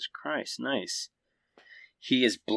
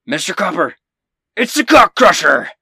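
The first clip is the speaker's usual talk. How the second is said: much higher and far louder battle cry, full voice; yelling out; 10 semitones higher, 14 dB louder than usual. Acted. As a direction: shout it across the battlefield. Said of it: "He yells out".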